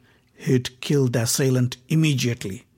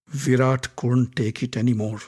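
ordinary delivery, nothing marked

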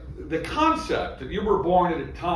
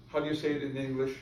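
softer, fast throwaway question; quick quiet aside; 8 dB quieter, faster than usual. Shown as a demonstration. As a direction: mumble it fast under the breath. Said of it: "Yeah, just say".

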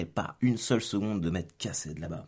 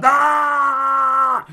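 drawn-out furious scream, very high and loud; long scream; 14 dB louder than usual. Retold or imitated. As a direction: let out a long scream of rage. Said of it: "j'ai gueulé non-stop".

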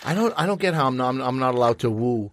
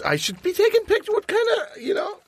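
excited; 10 semitones higher than usual. Acted. Hypes the pour like photographer.

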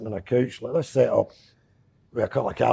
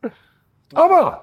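high, loud mock outrage at being called hostile; mock indignant; 5 dB louder than usual. Host says he's scared, comedian answers in high mock indignation. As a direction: play up the offended act.

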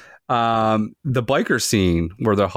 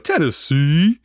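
high, drawn-out football play call; rising, stretched comic yell; Bill Murray impression; 8 semitones higher, slower than usual. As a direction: yell the play out, jumping higher at the end. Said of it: "He yells out".

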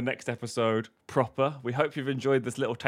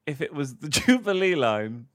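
ordinary talk

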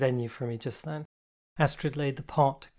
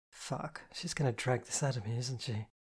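quiet muttered curse; grumbled under the breath; muttered aside; 8 dB quieter than usual. A narrator's reading. Inner thought, muttered aside.

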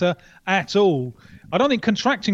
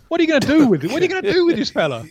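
higher, louder mocking voice, fast at the end; hammed-up jeering impression of a reckless leader; mocking the president; 8 semitones higher, 4 dB louder, much faster than usual. Rio correspondent mimicking the president taunting the press, higher and louder. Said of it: "he took off his mask".

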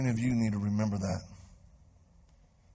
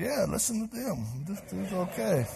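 high pleading echo; 8 semitones higher than usual. Retold or imitated. Preacher echoing friends to angry man.

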